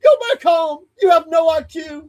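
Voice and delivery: high, loud mocking yell; screaming announcer. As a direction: scream insults at the loser. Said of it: "he would be screaming".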